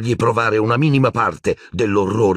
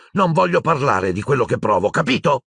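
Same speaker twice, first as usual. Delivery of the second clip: higher, snapping refusal; angry; 7 semitones higher than usual. A narrator's reading. Furious boy refusing to talk, higher and angry. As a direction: shut him down hard.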